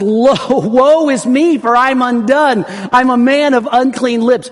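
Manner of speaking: high, loud prophet's cry; impassioned shouted lament; Isaiah crying out. Acted. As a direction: cry it out in full preaching force. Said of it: "Isaiah cries out and says".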